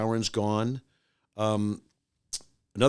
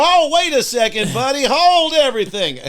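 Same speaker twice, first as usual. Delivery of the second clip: much higher and louder panicked stop; alarmed outburst; 11 dB louder than usual. Retold or imitated. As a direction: shout him down in mock horror. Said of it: "You guys go".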